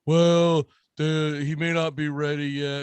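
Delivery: drawn-out "well", then mealy-mouthed excuse; mocking imitation of apologist fans; mock fan hedging. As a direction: mimic fans making feeble excuses. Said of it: "slurping the whole".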